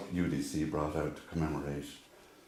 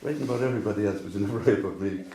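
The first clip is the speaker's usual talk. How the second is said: quick, higher mock complaint from a wife; nagging wife; 5 semitones higher, 5 dB louder, faster than usual. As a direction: imitate a wife's rapid-fire grumbling, pitched up and louder. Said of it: "She was always asking me".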